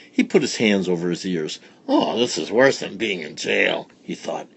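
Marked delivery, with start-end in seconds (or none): none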